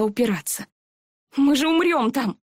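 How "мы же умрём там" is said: panicked. A narrator's reading. Eri panicking at thorns.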